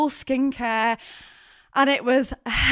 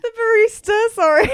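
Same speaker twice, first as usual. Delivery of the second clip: high, loud giggling apology; sorry squeezed out mid-giggle; laughing through pain; 13 semitones higher, 6 dB louder than usual. Real, not acted. Still giggling at the slip.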